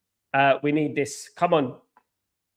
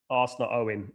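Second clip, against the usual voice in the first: ordinary talk, nothing marked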